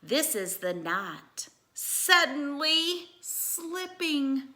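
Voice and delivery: lively storybook read-aloud; animated bedtime-story reading; reading to children. Acted. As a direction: read it like a picture book for kids.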